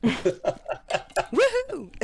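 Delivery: high, loud cheering whoop. Real, not acted. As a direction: let out a big excited woohoo. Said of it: "I just broke my engineer's eardrum".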